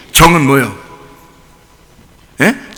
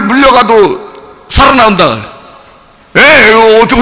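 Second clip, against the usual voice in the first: normal talking voice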